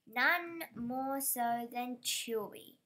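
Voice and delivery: ordinary delivery, nothing marked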